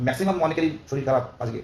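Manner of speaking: ordinary talk, nothing marked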